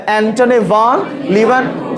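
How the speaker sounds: Antony ভন Leeuwen said slowly, syllable by syllable; slow pronunciation drill. Shown as a demonstration. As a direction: drill the name slowly for students. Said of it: "উচ্চারণ করতে হবে". (ধীরে ধীরে নামটা উচ্চারণ)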